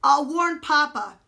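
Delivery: loud, high mock-generous offer; boy offering to warn the king; offered generously. A narrator's reading. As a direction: sell it as a kindness. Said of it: "Jemmy offered generously".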